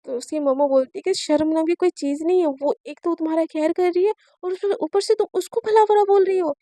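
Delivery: usual speaking voice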